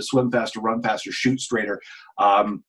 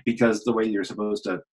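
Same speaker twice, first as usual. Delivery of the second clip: ordinary talk, nothing marked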